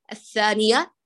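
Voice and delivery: ordinary delivery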